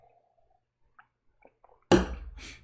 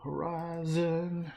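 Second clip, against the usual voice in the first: "Horizon." stretched slowly in a higher cowboy drawl; a lightly done cowboy accent, higher and slow; 6 semitones higher, much slower than usual. Shown as a demonstration. Repeats the word in the cowboy accent.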